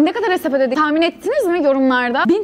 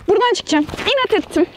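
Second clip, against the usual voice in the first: ordinary talk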